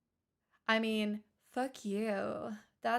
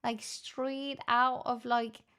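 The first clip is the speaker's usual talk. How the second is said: put-on Irish brogue, settling toward usual pitch; bad Irish accent. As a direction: brag in a deliberately poor Irish accent. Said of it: "I sound so incredibly Irish".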